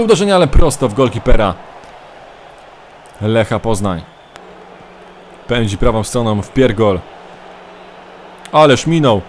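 energetic play-by-play commentator voice; live match commentary. Acted. Mock football commentary of own match.

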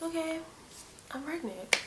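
soft, subdued self-convincing; hushed realization to oneself; mental self-talk. Retold or imitated. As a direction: say it quietly to let it sink in. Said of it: "trying to mentally tell myself".

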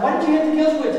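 dad pressing his son; exasperated question. Retold or imitated.

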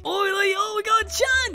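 high, thrilled shout of her name; excited greeting. Real, not acted. Spotting Shan in the game, thrilled high call. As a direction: spot a friend and squeal her name.

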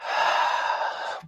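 long, soft audible breath; demonstrative breath. Shown as a demonstration. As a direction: show a slow calming breath.

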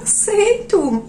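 high, very slow, drawn-out yes; coy acceptance. Retold or imitated. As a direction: accept the flower like a flattered lady. Accepting the flower coyly. (aceito arrastado e agudo, faceiro)

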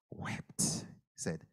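hushed, reverent whisper. A narrator's reading. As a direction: say it barely audible. Hushed narration of Jesus weeping.

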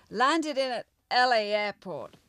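ordinary talk

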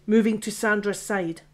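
ordinary delivery

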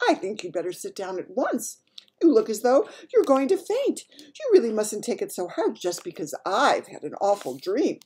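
a touch of royal sharpness; rather sharp. Acted. Queen telling her maid to sit, rather sharp. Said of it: "saying rather sharply".

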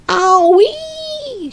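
very high, long-held squeal; scream. A narrator's reading. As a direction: squeal it out and hold the end. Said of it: "she screamed".

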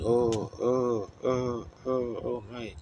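a retold ghostly moan, oh after oh, fading away at the end; mournful moaning women. Preacher imitating the damned women's mournful moans. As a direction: moan like damned souls in torment. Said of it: "they were going".

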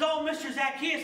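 bright excited welcome; happy and exuberant. Acted. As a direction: greet him like the best news ever. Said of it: "happy and exuberant and, and excited".

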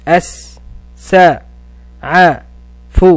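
السعف broken into أس، س، ع، ف, each sound apart; syllable split. Shown as a demonstration. Teacher sounds out السعف letter by letter for pupils. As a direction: sound out أس، س، ع، ف one by one with pauses. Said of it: "ثم أحللها إلى مقاطع وحروف".

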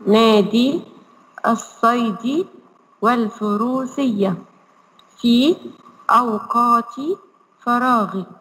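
put-on slow syllable-by-syllable reading for children; slow model reading. Shown as a demonstration. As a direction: read each word slowly so the kids can repeat. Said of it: "اقرأوا معايا وقولوا معايا". (قراءة بطيئة واضحة للأطفال)

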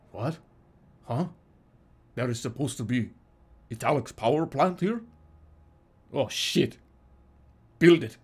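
halting, badly faked Russian accent; struggling mock Russian accent; attempted Russian accent. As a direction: fumble through a put-on Russian accent. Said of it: "it's hard to do a Russian accent".

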